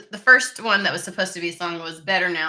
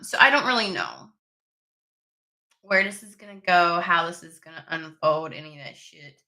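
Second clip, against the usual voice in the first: usual speaking voice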